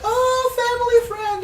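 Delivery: very high, loud mock-mother squeal; gushing mother. Mother greeting news of a family friend, gushing high squeal. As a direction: mimic a delighted mother in high falsetto. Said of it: "my mother's like".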